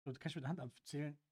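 ordinary delivery

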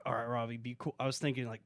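pleading under his breath for Robbie to behave; scared inner whisper. Retold or imitated.

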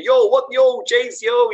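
high, loud mock street-lad greeting. Telling a friend what he expected, hammed-up street greeting. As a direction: play the lad shouting yo at his mate. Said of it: "sound like Des or Mikey".

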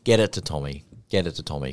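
ordinary talk, nothing marked

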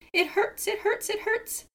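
very high pained wail. A narrator's reading. Seagull wailing at Raven pulling spines, very high wail. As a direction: wail it, near tears. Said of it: "wailed Seagull".

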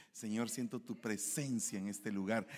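soft, intimate worship; tender prayer. Retold or imitated. A lightly voiced driver praying tenderly to God. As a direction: pray softly like in the car.

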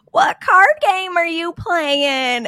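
put-on high, slow Southern little-boy voice; little Southern accent. Little boy bugging card players, little Southern accent. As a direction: imitate a pestering Southern boy, drawing it out. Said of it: "bug us and be like".

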